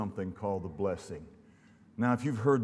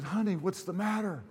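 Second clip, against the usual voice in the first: imitated husband's higher-pitched question; acted concern, voice lifted high; 9 semitones higher than usual. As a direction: ask it high, as a worried husband would. Husband asks his crying wife.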